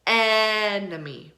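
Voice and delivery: "enemy" with a long stressed EH at the start; slow EHN-uh-mee for "enemy"; stressed short E. Shown as a demonstration. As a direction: stretch the opening eh of "enemy". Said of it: "It sounds like eh".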